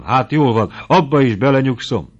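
delighted lover's exclamation; joy-drunk cry. A narrator's reading. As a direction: give in gladly and brightly. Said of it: "kiáltott fel Noszti örömittasan".

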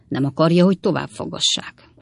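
ordinary delivery

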